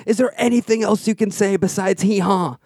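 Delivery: high-pitched acted outburst; exasperated. Lost man confronting donkey, exasperated plea. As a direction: demand an answer, at the end of patience.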